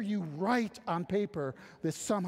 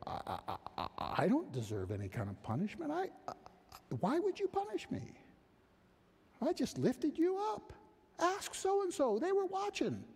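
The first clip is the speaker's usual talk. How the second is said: whining offender; 12 semitones higher than usual. Acted. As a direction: play the wide-eyed guilty one begging off. Puncher whining self-justification.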